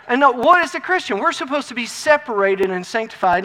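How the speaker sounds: loud, driving sermon delivery; emphatic pulpit exhortation; forceful preaching. Real, not acted. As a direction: preach it hard, punching the commands. Preaches holiness at full force.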